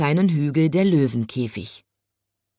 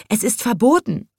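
higher-pitched, mildly alarmed zookeeper; startled; 6 semitones higher than usual. A narrator's reading. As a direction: object with a touch of fright. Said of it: "sagte der kleine Zoowärter erschrocken". (leicht erschrocken)

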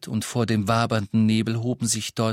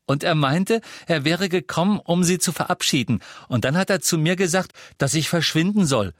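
put-on high, eager boy's voice; excited report; 8 semitones higher, 4 dB louder than usual. A narrator's reading. Boy tells parents what he saw, excited. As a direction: keep pouring out the gossip. Boy goes on telling his gossip.

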